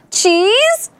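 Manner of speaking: high, coaxing question; tempting high-pitched offer; bribing. A narrator's reading. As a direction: dangle a treat with a rising lilt. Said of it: "and bribed".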